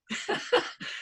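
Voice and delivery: soft, high giggle; real breathy little laugh to oneself. Real, not acted. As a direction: let out a quiet high chuckle. Amused at own teaching.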